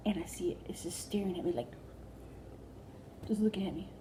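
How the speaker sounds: hushed whisper while hiding. Retold or imitated. Whispering, hiding from the bug behind the recliner. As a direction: barely breathe it out, scared to be heard.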